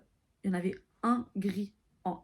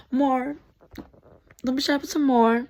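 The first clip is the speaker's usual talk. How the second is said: urging loudly; 6 semitones higher, 10 dB louder than usual. Real, not acted. Urging someone to pour more.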